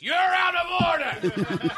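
very high, drawn-out comic yell; slow, high-pitched courtroom outburst; mock courtroom shout. Judge calling the podcast mid-trial, mock shout. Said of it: "shout back at the judge".

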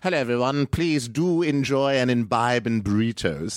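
high-pitched put-on British voice; British accent. Shown as a demonstration. Comedian does British on the host's request, British accent. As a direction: do a polite, high British greeting. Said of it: "Now, British".

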